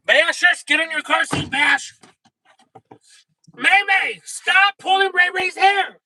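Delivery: high, loud mock mom yell. Mom yelling at her kids in the car, hammed-up. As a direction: shout at the kids in the back seat.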